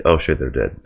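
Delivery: ordinary talk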